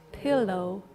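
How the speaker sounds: usual speaking voice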